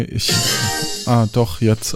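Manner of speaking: usual speaking voice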